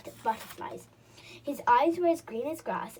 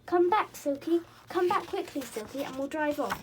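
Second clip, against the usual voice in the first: high, loud urgent calling; 5 semitones higher, 6 dB louder than usual. Acted. Rabbit calling Silky back to the car, loud and urgent. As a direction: call her back, fast and worried. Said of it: "called the rabbit".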